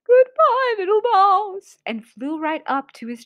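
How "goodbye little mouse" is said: high, loud hooty farewell; pitched-up owl panic; scared owl. Acted. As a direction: cry goodbye as a fleeing owl. Owl flees.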